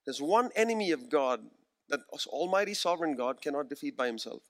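ordinary talk, nothing marked

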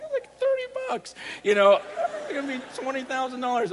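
squeaky mock outrage, very high; incredulous. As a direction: pitch it up like disbelief at the price. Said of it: "I'm like".

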